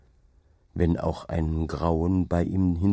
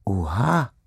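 plain reading voice

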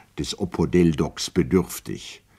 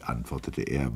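ordinary delivery, nothing marked

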